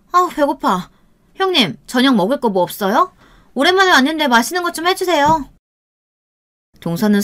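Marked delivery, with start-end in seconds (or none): 0.1-5.5 higher, whining sister-in-law begging for dinner; whiny voice. Acted. Sister-in-law demanding dinner from the narrator, high and whiny. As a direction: pitch it up and whine for food. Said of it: "배가 고프다고 중얼거렸어요".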